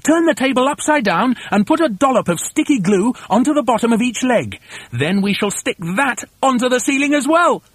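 high, excited emphatic orders; high-pitched rallying foreman; shouted instructions. A narrator's reading. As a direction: lay out the plan with bossy urgency. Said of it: "shouted Mugglewump".

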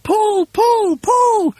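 very high, repeated urgent yells; high-pitched frantic heave-ho; yelling. A narrator's reading. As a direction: drive the team on with each yell. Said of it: "yelled Mugglewump".

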